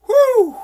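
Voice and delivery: loud, high whew; exasperated whew. Real, not acted. Man venting about being bothered after work, exasperated whew. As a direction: blow out a sharp, high whew of frustration.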